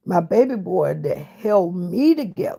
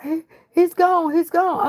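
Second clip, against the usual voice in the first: imitating a hysterical, crying wife; high-pitched frantic wail, retold; panicked, much higher voice, stammering the news; 10 semitones higher than usual. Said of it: "she's hysterical. She's crying".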